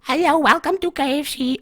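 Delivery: high-pitched put-on server greeting; drive-through lady voice. K F C lady greeting a car at drive-through, put-on high voice. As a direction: imitate a fast-food lady's greeting. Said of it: "So the lady says ... Or however they talk".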